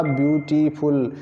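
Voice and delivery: ब्यूटीफुल slowly split as ब्यू-टी-फुल; syllable by syllable. Shown as a demonstration. Teacher has students notice the places of articulation, syllable by syllable. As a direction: stretch ब्यूटीफुल out in separate parts, ब्यू, टी, फुल. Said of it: "आप कितने उच्चारण स्थानों का प्रयोग करते हैं".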